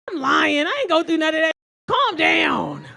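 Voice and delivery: high, very loud exasperated shout; shouted comeback. Acted. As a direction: yell him down at full volume. She shouts him down, acted.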